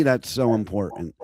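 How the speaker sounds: ordinary talk, nothing marked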